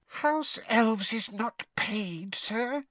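slow, high, horrified elf voice; muffled squeak. A narrator's reading. Horrified Winky corrects Harry, muffled squeak. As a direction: squeak it slowly from behind your hands. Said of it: "she said in a muffled squeak".

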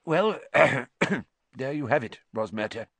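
throat-clearing, choked official; acted lump in the throat, high then falling; thick-voiced. A narrator's reading. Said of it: "said Fudge thickly".

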